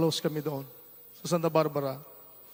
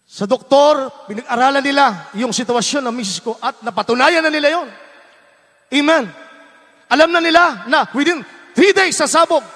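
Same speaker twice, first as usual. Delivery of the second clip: louder and higher, preaching at full force; rising preacher shout; 9 semitones higher, 10 dB louder than usual. Real, not acted. Preacher raising his voice to the congregation, fervent shout. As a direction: build the testimony into a fervent shout.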